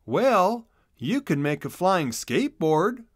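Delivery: put-on a small boy with a bright idea; little brother voice. A narrator's reading.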